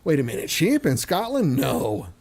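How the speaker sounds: sarcastic disbelief, pitch swooping up; mock surprise. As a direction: feign shock at something obvious. Mock shock at sheep in Scotland.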